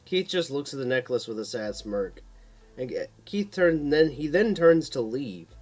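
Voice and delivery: usual speaking voice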